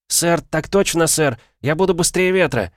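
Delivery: put-on high, bright, keen girl soldier; eager to please the commander; eager young soldier.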